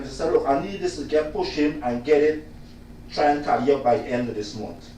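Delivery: ordinary talk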